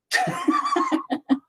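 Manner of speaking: sudden high-pitched laugh. Real, not acted. Painter laughing at his own frustration. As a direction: burst into a high giggle. Laugh after whispered curse.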